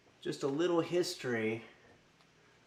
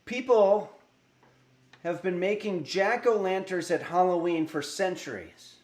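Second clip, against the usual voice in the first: storytelling read; 4 semitones higher, 3 dB louder than usual. Acted. Reads jack-o'-lantern history.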